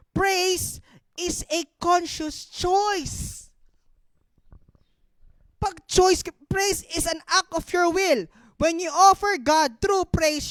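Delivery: slow, emphatic reading, projected loudly; deliberate, loud pulpit declaration; preacher reading aloud. Real, not acted. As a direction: read it out weightily, word by word. Reads a point out to congregation.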